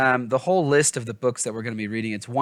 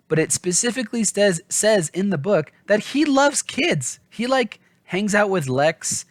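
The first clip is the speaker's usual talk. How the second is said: eager emphasis; 8 semitones higher, 3 dB louder than usual. Real, not acted. Eagerly stressing book difference.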